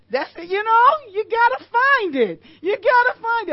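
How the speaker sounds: preaching exhortation. Real, not acted. Pastor urging the congregation to find the good, loud exhortation. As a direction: drive the point home at full voice.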